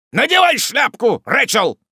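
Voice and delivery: high, loud, insistent order; angry command. A narrator's reading. Wardle orders his sister home, angry command. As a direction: drive her out with the command.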